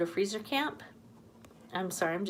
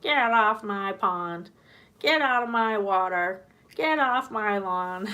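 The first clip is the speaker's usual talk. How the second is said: put-on slow, louder grumpy old-man voice; cranky old man duck; 2 semitones higher, 7 dB louder, slower than usual. Voicing the old man duck chasing others off, slow and loud. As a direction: shoo everyone off like a grouchy grandpa. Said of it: "this old man duck waddling around being like".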